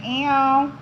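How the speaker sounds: disgusted high little ew; mock disgusted ew. Women mocking the men with a disgusted ew. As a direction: wrinkle your nose and say ew. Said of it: "All my earth angels say".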